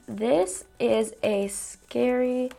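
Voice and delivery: very slow, soft dictation while typing; dictating slowly. Acted. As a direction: spell out each word slowly as if typing it. Typing her prompt aloud.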